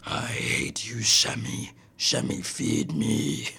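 low, slow, breathy demon dog voice; a possessed dog rasping its demand. Retold or imitated. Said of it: "screaming at me in, like, a demon voice".

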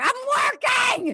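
high, slow yelled "I'm working!"; hammed-up shout of "I'm working!"; mock shout. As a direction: holler it like a busy coworker. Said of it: "that's how I act when I'm at work".

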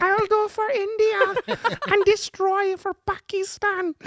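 very high, slow mock Indian accent. Asked by co-host to do it, a high, slow mock Indian accent. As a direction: ham up a cartoon Indian accent, stretched out. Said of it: "So do it in an Indian accent then".

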